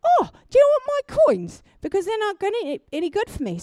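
high, bright-voiced kindly stranger offering coins; hammed-up cheerful stranger voice. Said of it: "and they're like".

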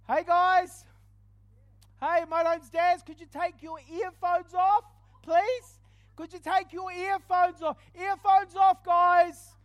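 loud, high retold call to a whole plane cabin; loud, high-pitched address to strangers, reenacted; calling out to passengers. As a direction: raise your voice over the cabin noise, nervous but bold. Retells preaching loudly on plane.

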